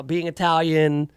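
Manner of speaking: ordinary delivery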